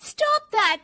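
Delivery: high, loud snap; loud angry outburst. Acted. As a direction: blurt it out angrily under pressure. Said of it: "जोर से गुस्से से बोल देती".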